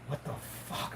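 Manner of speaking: whispered in disbelief; a hushed what the fuck; stunned whisper. Retold or imitated. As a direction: breathe it out, dumbfounded. Retold stunned reaction, confused.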